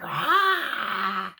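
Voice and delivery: loud drawn-out "aah" roar; lion roar. Acted. Storyteller as the lion trapped in the net, roaring.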